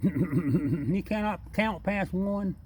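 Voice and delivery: high, slow, laughing through the joke. Real, not acted. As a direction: draw it out, cracking up at the raccoon.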